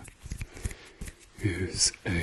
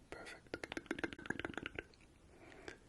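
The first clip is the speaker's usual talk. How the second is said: fading whispered praise; 16 dB quieter, slower than usual. Acted. Repeated praise fading far off.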